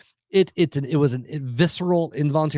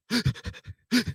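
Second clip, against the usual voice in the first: gasp; 10 semitones higher, 4 dB quieter than usual. Retold or imitated. Panic attack retold.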